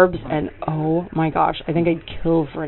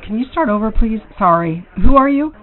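loud high question; 5 semitones higher, 9 dB louder than usual. Pretend. Asks caller loudly, high.

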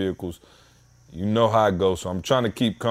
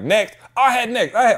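mock squabbling; 13 semitones higher, 5 dB louder than usual. Crowd fighting over turns at DMV.

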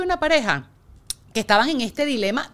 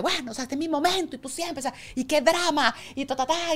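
higher-pitched retold nagging, not really shouted; mock scolding; 3 semitones higher, 3 dB quieter than usual. Therapist imitates wife scolding stressed husband, mock scolding. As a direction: imitate a fed-up wife's tirade. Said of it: "y le grita, ¿no? Como que".

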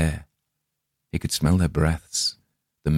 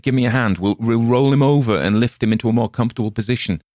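a lightly voiced helpful stranger, a bit faster; eager bystander offering to help, brisk; a bystander's voice; 5 semitones higher, 4 dB louder, faster than usual. A narrator's reading. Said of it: "Someone said".

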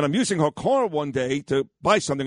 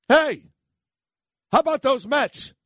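sergeant calling out, much higher pitch; retold sergeant; 10 semitones higher than usual. Sergeant hailing the arrested radio host at his desk, retold high call-out. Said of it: "the sergeant goes".

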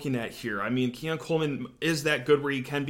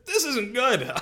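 pitched higher, half-laughing emphasis; emphatic repeat; 9 semitones higher, slower than usual. Shown as a demonstration. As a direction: repeat it higher for comic effect. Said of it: "How about that for analysis right there?".

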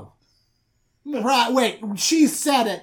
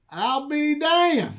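high, slow drawn-out amazement; sheepish dad; 10 semitones higher, 4 dB louder, much slower than usual. Retold or imitated. Father admitting he was wrong, slow and high.

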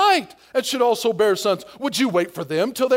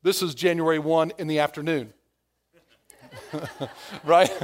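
ordinary delivery

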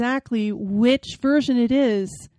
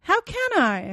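exasperated question; 9 semitones higher than usual. Acted. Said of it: "I said at length".

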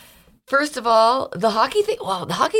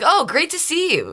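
higher-pitched, bubbly hello; delighted greeting; 11 semitones higher than usual. Retold or imitated. Host greeting Canadian guests on the show, delighted. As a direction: greet an old acquaintance brightly, pitched up.

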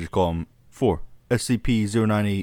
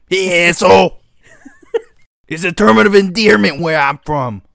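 loud, high gruff street voice; tough guy with cigar; 9 semitones higher, 9 dB louder than usual. Retold or imitated. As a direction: bark it like a cigar-chomping crab. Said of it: "They got, like, a cigar hanging".

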